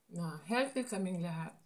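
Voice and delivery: ordinary talk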